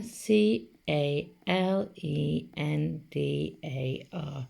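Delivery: slow, evenly spaced letters; letter by letter, unhurried; spelling aloud. Shown as a demonstration. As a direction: spell it out slowly for the class to join in. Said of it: "Say it together".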